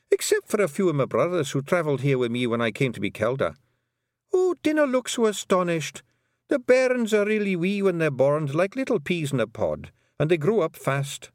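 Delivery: put-on high, Scots-accented old Pictsie matriarch; a tiny Scottish clan mother, proud and fond; old Kelder voice. The Kelder telling Tiffany about her sons, high and Scottish.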